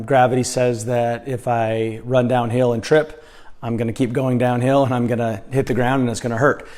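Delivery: ordinary talk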